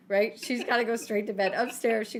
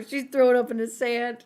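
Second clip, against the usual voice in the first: ordinary talk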